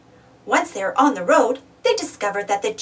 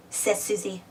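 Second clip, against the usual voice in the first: plain reading voice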